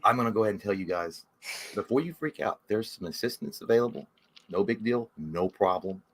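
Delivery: low, soothing sales patter; smooth vet staffer. Acted. Vet staff easing owners into the bill, smooth and low. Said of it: "they come out to you, like, smooth".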